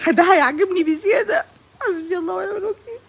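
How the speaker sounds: high, whimpering fake sob; mock crying. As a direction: wail it like a hammed-up cry. Comic fake crying lament.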